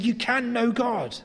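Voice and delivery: KNOW stretched out, pitched high; slow, high, insistent assertion on "You can know God"; emphatic. Retold or imitated. Paul answering people who say they don't know him, emphatic. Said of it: "Paul was emphatic".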